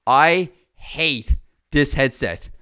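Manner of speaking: ordinary talk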